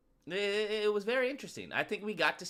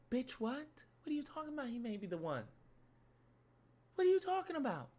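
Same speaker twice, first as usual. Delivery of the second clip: high, soft-voiced disbelief; incredulous girlfriend; 5 semitones higher, 8 dB quieter than usual. Girlfriend hearing he may be the one, incredulous. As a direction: question her in high-pitched shock. Acting her friend's disbelief.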